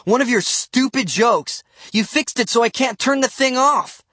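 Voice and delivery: high, indignant complaint; accusing. A narrator's reading. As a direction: lay into him angrily. Angry accusation in quarrel.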